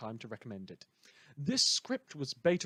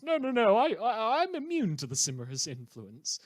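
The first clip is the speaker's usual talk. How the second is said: a light, higher mock of a hero protesting; mocking Alexandria; 8 semitones higher, 5 dB louder than usual. The host mimics Alexandria's denial with a light, higher mocking voice. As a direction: quote her with a hint of prissy denial. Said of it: "so long as she says".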